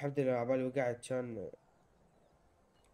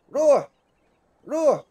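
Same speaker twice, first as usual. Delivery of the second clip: high, loud one-word burst; sharp high shout of urging; 10 semitones higher, 12 dB louder, slower than usual. Real, not acted. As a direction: yell the command at the game. Urging the game character on.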